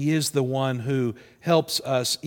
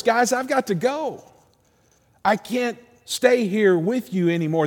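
raised, higher-pitched announcement to close friends; voicing Jesus; 7 semitones higher than usual. Jesus telling the disciples he must leave, voiced higher and insistent. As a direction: break the news in a high, insistent voice. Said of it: "He says to the disciples".

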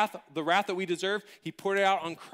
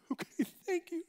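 tiny, high squeak; meek pupil thanks; 13 semitones higher, 5 dB quieter than usual. Retold or imitated. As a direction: squeak it out softly, mock-tearful. Tearful pupil's relieved thanks.

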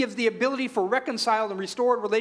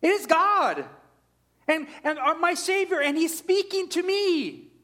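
much higher and louder, urgent wake-up call; urging his own soul to wake, high and excited; urgent self-call; 10 semitones higher, 3 dB louder, slower than usual. Acted. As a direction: rouse your own soul, pitched high. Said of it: "yourself kind of saying to yourself".

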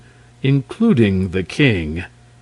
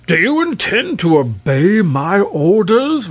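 high, strained royal roar; 9 semitones higher, 4 dB louder than usual. A narrator's reading. King demanding the dragon obey orders, roaring. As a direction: demand obedience in a rising roar. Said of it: "roared the king".

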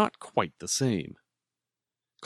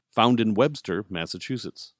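ordinary delivery

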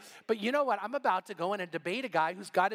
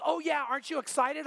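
excited; 6 semitones higher than usual. Retold or imitated. Joanne thrilled, quoted.

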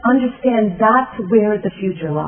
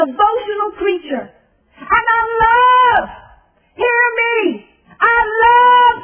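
tearful build to a shouted finish; crying climax; 13 semitones higher than usual. Acted.

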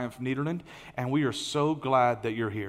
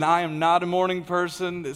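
normal talking voice

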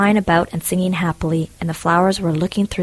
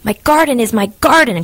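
loud, high, angry giant's cry; 5 semitones higher, 6 dB louder than usual. A narrator's reading. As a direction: cry it out in anger. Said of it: "cried the giant".

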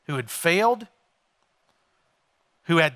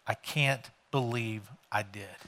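hushed regret; 6 semitones lower, 10 dB quieter than usual. Retold or imitated. Peter's regret voiced in sermon.

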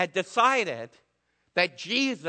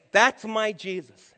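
louder, slow declaration; proclaiming; 2 semitones higher, 7 dB louder, slower than usual. Acted. Preacher voicing the believer's answer, slow and louder. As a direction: announce it like a vow.

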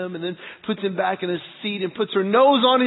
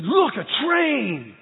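slow, drawn-out, higher lure; mock excitement; 4 semitones higher, much slower than usual. Dad luring train-loving boys, hyped-up excitement. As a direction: sell it big to small kids.

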